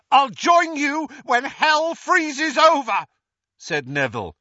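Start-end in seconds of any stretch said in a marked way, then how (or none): 0.1-3.1 high, loud, slow defiance; loud high scornful refusal; defiant scorn. Acted. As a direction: spit the refusal at him. Neville defies Voldemort.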